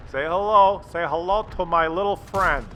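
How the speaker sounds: loud mock Tony Montana growl; hammed-up movie gangster line; Scarface quote.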